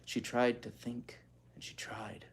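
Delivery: hushed whisper of the line; whispered echo. Shown as a demonstration. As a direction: breathe it out, almost silent. Whispered repeat of narration.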